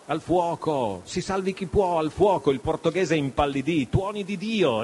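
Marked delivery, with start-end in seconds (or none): 0.1-2.5 mild raised-pitch cry; narrated shout of alarm. A narrator's reading. Pirates raising the fire alarm aboard, restrained shout. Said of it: "si udirono alcune voci gridare". 3.9-4.8 higher-pitched oath; exclamation. A narrator's reading. Portuguese pirate reacting to fire aboard, exclaiming. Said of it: "esclamò".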